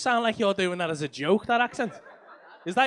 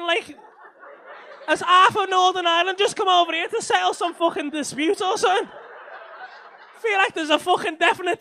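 loud, high-pitched incredulous riff; high, loud comic alarm; worked-up rant; 7 semitones higher, 5 dB louder than usual. Real, not acted. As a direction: shout over the room in mounting disbelief. Riffing loudly on tension in room.

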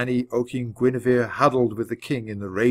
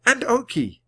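high, quick added cheer; mild shout; 11 semitones higher than usual. A narrator's reading. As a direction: tack it on as a high-pitched cheer. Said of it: "shouted Annie".

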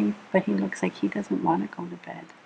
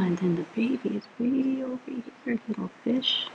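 soft higher cooing voice; 5 semitones higher, 7 dB quieter, faster than usual. Real, not acted. Cooing over the baby fish.

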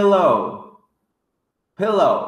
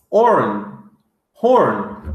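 usual speaking voice

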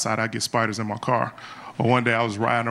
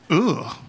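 imitated dismissive "ugh"; mock a stranger's disdainful grunt; short, scornful groan of distaste; disgusted ugh; 2 semitones higher than usual. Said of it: "This is exactly what she said".

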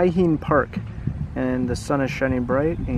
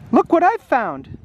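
put-on higher, slow, wondering girl's voice; excited girl voice; 9 semitones higher than usual. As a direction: Mimi shows what she found, high and slow. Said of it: "said Mimi".

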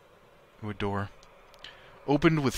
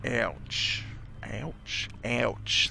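testing a southern "ouch"; attempted southern accent; 3 dB quieter, much slower than usual. Shown as a demonstration. Player practising a character's ouch, tentative southern accent. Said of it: "trying to remember what southern accent sounds like".

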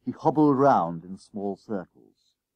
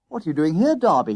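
demanding; 7 semitones higher, 6 dB louder than usual. A narrator's reading. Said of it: "he demanded".

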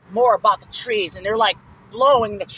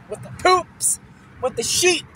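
pitched way up, silly squeak; oddball squeaky voice; 8 semitones higher, 4 dB quieter than usual. Acted. Boy makes oddball noises until grandpa says quit. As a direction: squeal the words to annoy. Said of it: "Making those oddball noises".

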